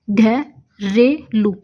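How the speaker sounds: घरेलू stretched out very slowly, syllable by syllable; slow drawn-out reading. Shown as a demonstration. Teacher reading the shorthand word घरेलू to students, very slowly drawn out. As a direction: draw out घरेलू slowly as if tracing it. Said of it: "तो अब घरेलू में देखिए".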